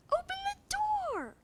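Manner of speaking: high, urgent yell. A narrator's reading. Lex yells at the boys to open the door. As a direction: scream for them to open up. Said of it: "Lex yelled".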